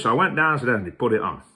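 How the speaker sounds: ordinary talk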